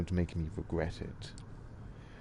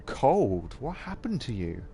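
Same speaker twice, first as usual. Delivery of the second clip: tender sister voice; 4 semitones higher, slower than usual. Acted. Sister in a tearful embrace.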